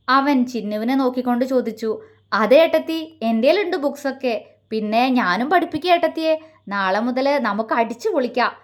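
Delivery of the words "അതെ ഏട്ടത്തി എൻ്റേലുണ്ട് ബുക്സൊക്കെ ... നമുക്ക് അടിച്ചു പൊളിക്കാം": plain reading voice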